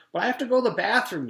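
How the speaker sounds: fast, high, loud desperate yell. A narrator's reading. Boy stuck on the stairs yells he needs the bathroom, fast and loud. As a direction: yell it in a panicked rush. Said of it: "yelled Stephen".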